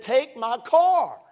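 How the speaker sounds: high, loud demand; insistent command. Retold or imitated. Joe ordering the young preacher to take his car, loudly. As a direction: push the order hard. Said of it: "he said".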